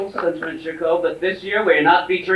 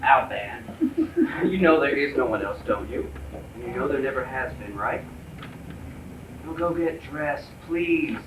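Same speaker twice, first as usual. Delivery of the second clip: low, soft coaxing; tender reassurance; 5 semitones lower, 8 dB quieter than usual. Acted. Husband assures Alvin there is no one else, soft tender reassurance. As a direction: reassure gently, voice dropped right down.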